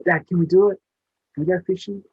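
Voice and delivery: put-on high, loud child's plea to dad; eager little boy. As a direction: beg like an excited six-year-old. Child voiced begging his dad.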